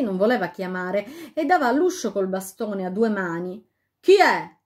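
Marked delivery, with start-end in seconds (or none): 4.0-4.6 loud, sharp shout through the door; yelled. A narrator's reading. The fairy yells at the knocking queen. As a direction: bark it out from inside. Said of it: "urlò finalmente una voce".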